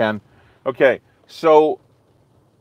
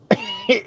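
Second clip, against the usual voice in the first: high giggle; 4 dB quieter than usual. Real, not acted. Laughing at own joke.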